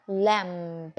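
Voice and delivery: "lamb" with the b sounded at the end; wrong pronunciation. Shown as a demonstration. Teacher showing her old wrong "lamb" with a b. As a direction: say "lamb" ending on a clear b. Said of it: "it's not lamb, but it's lamb".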